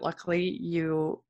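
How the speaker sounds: ordinary delivery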